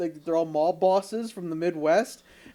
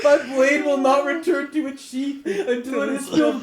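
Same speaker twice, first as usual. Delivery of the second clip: laughing quote; 8 semitones higher, 3 dB louder than usual. Retold or imitated. Quoting the movie line laughing.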